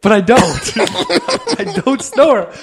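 mock outrage. Defending himself to his roommate, hammed-up high indignation. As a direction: protest like a wronged noble.

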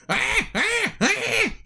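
very high squeaky creature cries; very high squeaks of a pleading creature. Acted. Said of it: "Shaft looks over and goes".

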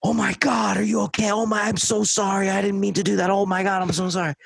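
high, frantic repeated apologies; hammed-up panicky apology; mock frantic guy saying sorry; panicked apologizing guy. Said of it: "the guy's following me".

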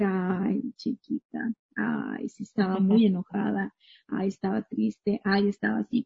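hushed, near-whispered self-comfort; soft self-pity. Pretend. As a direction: talk to yourself like a tender confidante, under your breath. Gently reading back one's own diary.

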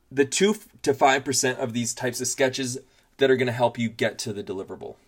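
usual speaking voice

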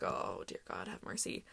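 hushed dread-filled prayer; whispered plea. Retold or imitated. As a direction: mutter a prayer for mercy. Praying before the pitch.

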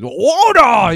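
high, loud drawn-out mock bellow; Masameda's cry. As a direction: bellow it as a comic imitation.

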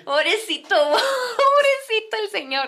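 high, drawn-out cooing pity; amused pity. Real, not acted. Storyteller feeling for the poor man, amused sympathy. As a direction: pity him with a laugh.

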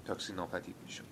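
ordinary delivery, nothing marked

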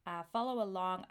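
ordinary talk, nothing marked

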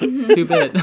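high, loud laughing words; laughing outburst. Real, not acted. Cohost cracking up over Croc holes, laughing loudly. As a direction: crack up mid-phrase.

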